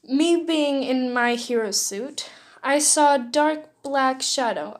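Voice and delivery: ordinary delivery, nothing marked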